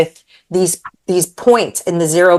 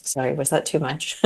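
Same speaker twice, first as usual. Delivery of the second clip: much softer, embarrassed little aside; sheepish; 3 semitones lower, 10 dB quieter than usual. Real, not acted. Guest apologizing to host for rambling, sheepish and soft. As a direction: shrink into the apology.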